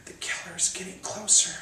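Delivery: eerie whisper. Acted. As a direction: lean in and whisper the threat.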